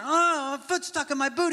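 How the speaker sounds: high, loud mock wail; freaking out. Son stuck in his boot to dad, mock freaking out. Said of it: "he just starts freaking out".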